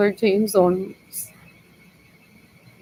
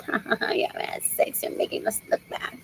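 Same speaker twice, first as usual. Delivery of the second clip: mock mocking voice; 10 dB quieter than usual. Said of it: "They're like".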